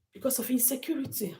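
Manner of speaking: quick hushed whisper of the phrase; whispered repeat. Real, not acted. Repeating the danger to viewers, whispered. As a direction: breathe it out as a grim whisper.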